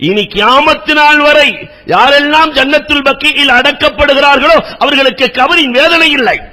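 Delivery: high, loud pleading sermon cry; fervent preaching. Acted. Preacher urging the congregation to pray and weep, impassioned high preaching cry. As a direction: urge the crowd to weep in prayer.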